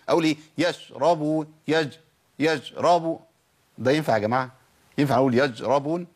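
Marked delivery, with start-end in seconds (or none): 0.6-3.2 يشربون with the sh chopped into a j, "yajrabun"; cut-off shin. Shown as a demonstration. Teacher asking if this works, shin cut into jim. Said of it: "أو قطعت الصوت في الشين". 5.3-6.0 يجربون with j where the sh of yashrabun belongs; shin as jim. Shown as a demonstration. Teacher asking "is that allowed?", shin turned into jim. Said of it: "دخلت على مخرج الجيم".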